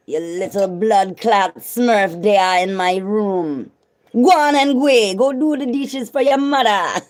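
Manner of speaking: scolding Jamaican patois; Jamaican accent. Pretend.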